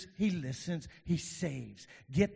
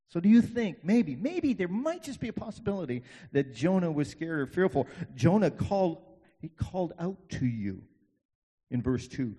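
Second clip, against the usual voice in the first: ordinary talk, nothing marked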